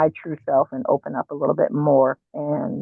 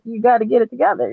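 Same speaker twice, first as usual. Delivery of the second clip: quoting a demand; 7 semitones higher, 4 dB louder, faster than usual. Retold or imitated. Quoting husband's stern demand.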